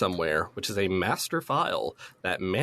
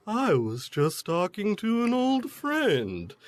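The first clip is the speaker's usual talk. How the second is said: villain voice; 10 semitones higher, slower than usual. Retold or imitated. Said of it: "And Ovan's like".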